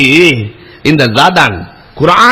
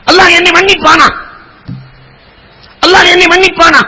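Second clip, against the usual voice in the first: anguished cry; 13 semitones higher than usual. Retold or imitated. Repentant father cries out to the Prophet, anguished. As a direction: beg for forgiveness in anguish. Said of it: "என்று அலறுகிறார்".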